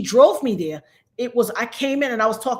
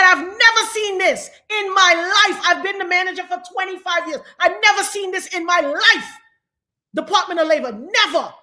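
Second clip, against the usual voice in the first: higher-pitched retold astonishment; raised, insistent exclaiming; emphatic disbelief; 6 semitones higher than usual. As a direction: act out a supervisor's outraged shock. Retold supervisor's shock at deletion.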